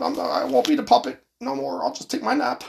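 high, thin put-on old man voice; hammed-up doddering old president; mock Biden asking for his nap. Comic old Biden impression.

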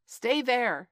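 high, loud barked order; monkeys shouting. A narrator's reading. Monkeys ordering Mowgli to stay, shouted. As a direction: yell it down the hole. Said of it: "shouted the monkeys".

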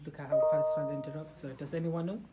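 ordinary talk, nothing marked

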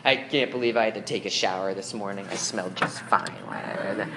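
low, sulky mumbled complaint; grumbling teen. Pretend. Preacher voicing a teen grumbling about the sunrise, low and sulky. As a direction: grumble it like a sleepy teenager.

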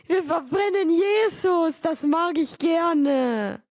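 high, loud, slow goofy voice; brother's brain voice. Retold or imitated. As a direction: stretch it out gleefully. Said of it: "Der hört sich nämlich circa so an".

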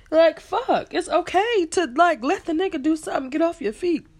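high, loud exasperated rant; excited rant. Real, not acted. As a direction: vent about it, worked up. Ranting about the sister.

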